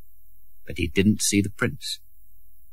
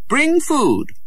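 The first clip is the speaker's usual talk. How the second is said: lightly voiced prince's order; 4 dB louder than usual.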